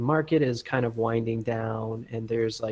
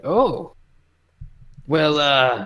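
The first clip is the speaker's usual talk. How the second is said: intimidated; 4 semitones higher, 6 dB louder, much slower than usual. Acted. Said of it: "you're very intimidating, and he's like".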